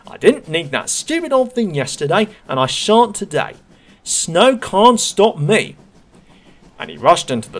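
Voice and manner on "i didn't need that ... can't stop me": boastful engine. Acted. Thomas boasting to himself about the snow, high and loud. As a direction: crow it like an engine too proud to stop.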